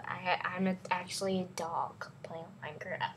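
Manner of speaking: whispered confiding aside. Pretend. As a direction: lean in and whisper.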